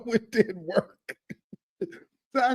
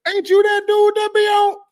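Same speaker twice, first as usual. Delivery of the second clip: much higher and louder, starstruck fan; excited fan voice; 12 semitones higher, 7 dB louder than usual. Retold or imitated. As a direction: squeal it like a thrilled fan in the store. Fan voice in retold scene.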